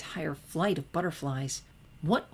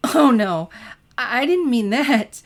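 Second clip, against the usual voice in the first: laughing; 7 semitones higher, 11 dB louder than usual. A narrator's reading. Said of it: "still unable to quell her laughter".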